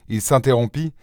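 ordinary delivery, nothing marked

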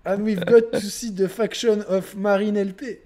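hammed-up French accent in English, slow. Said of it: "ils parlent anglais avec un accent français". (accent français moqueur en anglais, lent)

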